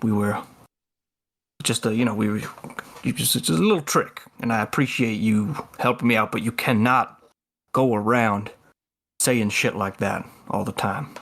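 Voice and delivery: low, measured confiding talk; Ledger. Acted. Ledger taking Halo aside after the act, low confiding lecture. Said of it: "puts an arm around Halo's shoulder".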